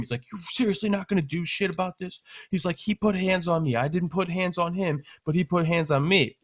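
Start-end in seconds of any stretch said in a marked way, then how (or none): 0.3-2.2 retold protest, pitched up; mock freak-out. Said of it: "He's yelling and screaming". 2.8-6.4 retold aggrieved insistence; mock freak-out. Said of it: "He's yelling and screaming".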